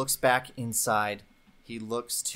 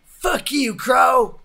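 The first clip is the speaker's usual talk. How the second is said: high, loud yell of rage; furious shout; 13 semitones higher, 10 dB louder, much slower than usual. Acted. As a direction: scream the insult across the street. Marshal screams at outlaw.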